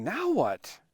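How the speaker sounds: keen and expectant, without shouting; excited asking. Retold or imitated. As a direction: ask it with lifted, contained excitement. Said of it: "excitedly asking".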